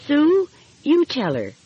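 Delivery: put-on timid girl nudging her sister to speak; shy little girl. A narrator's reading. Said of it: "said the other girl shyly".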